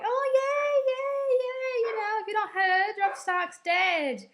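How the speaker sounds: mock the king's gloating; smug Joffrey. Imitating Joffrey gloating, high mocking voice. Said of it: "he's being all like".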